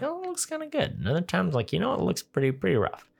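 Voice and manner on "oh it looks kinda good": much higher, softer mock gush; mock impressed. Movie reviewer voicing his impressed self, mock high gush. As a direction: squeak it out half impressed. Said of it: "Sometimes I'm like".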